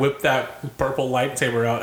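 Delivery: ordinary talk, nothing marked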